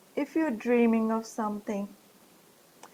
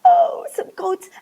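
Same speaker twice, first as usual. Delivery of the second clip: much higher and louder, thrilled; excited squeal; 14 semitones higher, 9 dB louder than usual. Acted. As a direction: squeal it with delight. Excited correction to goats.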